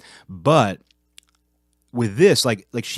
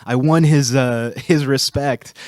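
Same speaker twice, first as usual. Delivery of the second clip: triumphant; 5 semitones higher, 5 dB louder, slower than usual. Real, not acted. Triumphant at winning respect.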